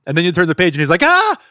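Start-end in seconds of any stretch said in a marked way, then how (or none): 1.0-1.4 hammed-up terror, high and drawn out; mock-panicked puppet accusing the reader; Grover. Grover catching the reader turning a page, mock terrified. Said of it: "he's terrified".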